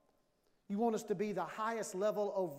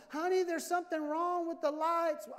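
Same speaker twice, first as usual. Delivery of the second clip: higher-pitched voice of a wife reporting a problem; lightly voiced wife calling to her husband; wife's request; 7 semitones higher, 3 dB louder than usual. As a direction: put it on higher, like a wife asking for a fix. Acts wife's request at home.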